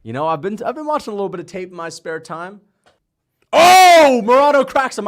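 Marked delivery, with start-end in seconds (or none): 3.5-5.1 high, loud sudden yell; excited shout. Real, not acted. Streamer watching his pick land a punch, excited yell. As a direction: burst out as the punch lands. Reacting live to a fight.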